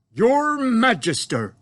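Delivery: loud, slow giant's greeting to the Queen; friendly giant. A narrator's reading. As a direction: boom it out like an eager, bumbling giant. BFG voiced in story.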